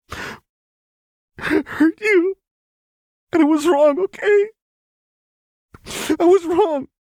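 very high, loud sobbing confession; tearful. A narrator's reading. Lux taking all the blame before Jinx, sobbing. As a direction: wail it through hot tears. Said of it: "tears fell in hot".

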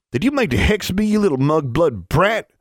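high, irate outburst; furious accusation. A narrator's reading. Ozarker boy accuses Alexandra of hexing him, high furious outburst. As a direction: hurl the accusation with rising outrage.

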